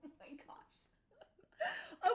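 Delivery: laughing through the words, breaking into a whisper; can't hold the giggles in; cracking up. Real, not acted. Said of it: "I can't even keep straight face".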